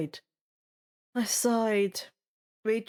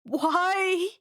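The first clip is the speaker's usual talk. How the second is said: frustrated cry; 7 semitones higher, 3 dB louder than usual. Acted. Frustrated outburst between tries.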